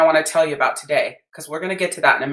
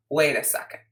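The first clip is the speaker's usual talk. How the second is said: usual speaking voice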